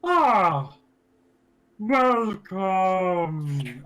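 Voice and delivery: booming giant greeting; dopey cartoon bellow. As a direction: welcome them like a big friendly giant. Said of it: "you hear a booming voice".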